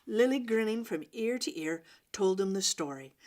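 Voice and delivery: usual speaking voice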